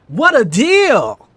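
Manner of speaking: very loud, high, slow salesman cry; over-the-top sales pitch; hammed-up ad. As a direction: sell it like a cheesy infomercial.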